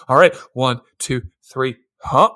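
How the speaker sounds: a low mock tally of claps; counting secretary. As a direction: count each clap under your breath. Voicing secretary counting with abacus.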